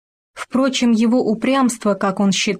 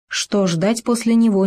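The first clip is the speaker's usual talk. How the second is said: ordinary delivery, nothing marked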